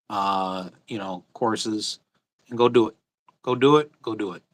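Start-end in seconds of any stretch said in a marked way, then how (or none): none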